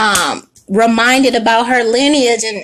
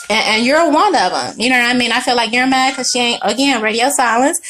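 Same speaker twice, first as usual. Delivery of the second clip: normal talking voice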